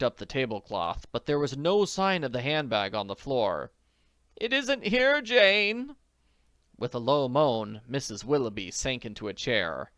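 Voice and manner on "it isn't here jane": high dismayed voice. Acted. Potter tells Jane bag gone, dismayed. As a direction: break the bad news.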